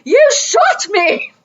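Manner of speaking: high, slow, drawn-out comic complaint; hammed-up outrage, voice way up; Austin Powers henchman. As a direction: imitate a henchman wailing at being shot. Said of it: "he said it in the same tone".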